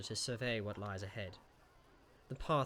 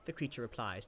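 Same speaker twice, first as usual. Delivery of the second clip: normal talking voice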